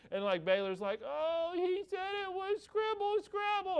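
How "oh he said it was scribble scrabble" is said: put-on very high, drawn-out little-kid wail; mock whine, pitched way up; heartbroken little girl. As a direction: imitate a crushed small child. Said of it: "broke her little heart".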